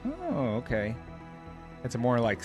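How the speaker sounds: ordinary delivery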